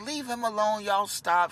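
high, scolding grandma. Retold or imitated. Grandma telling the cousins to stop, high scolding. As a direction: shoo them off like grandma. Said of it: "grandma's like".

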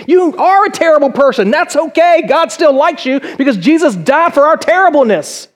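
high, forceful preaching; emphatic agreement. Real, not acted. Preacher answers the self-doubter, high and emphatic. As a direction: fire it back with gusto.

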